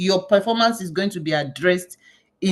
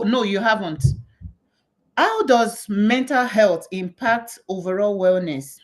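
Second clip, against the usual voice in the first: ordinary talk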